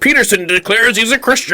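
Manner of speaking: high, loud breaking-news announcer voice; mock headline. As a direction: hype it like a clickbait headline. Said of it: "Be like".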